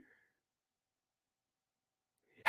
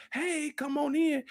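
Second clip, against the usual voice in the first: slow, higher, soft welcome; eerie welcome; 8 semitones higher, 8 dB quieter, slower than usual. Retold or imitated. Psychic luring Scully in, slow and higher. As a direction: lure them in. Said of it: "what'd she do?".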